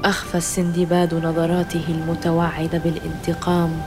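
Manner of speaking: ordinary delivery, nothing marked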